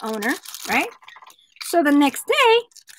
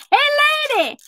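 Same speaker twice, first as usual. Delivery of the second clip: callback to the squawky bird voice; talking parakeet; 11 semitones higher, much faster than usual. Acted. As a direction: repeat the bird's catcall. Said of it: "Hey, lady" right there".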